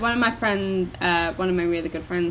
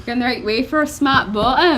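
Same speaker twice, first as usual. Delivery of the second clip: higher-pitched put-on Scottish; Scottish accent; 4 semitones higher, 4 dB louder than usual. Shown as a demonstration. Repeating the one Scottish sentence, higher Scottish accent. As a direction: have a firmer go at Scottish. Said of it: "a slight bit of Scottish".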